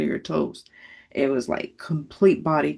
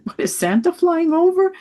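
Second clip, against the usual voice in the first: very high, louder, wide-eyed wonder; mock amazement; 13 semitones higher, 6 dB louder than usual. Mother imitating grown daughter wondering about Santa, amazed. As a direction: squeal the question like an amazed kid.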